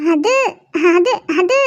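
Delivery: shy, halting stammer with a little laugh; bashful. Acted. Girl caught holding his hand in front of friends, bashful. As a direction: stall shyly on each word, half laughing. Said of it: "இப்ப என்னத்துக்கு இவ்ளோ வெக்கப்பட்டுட்டு இருக்க".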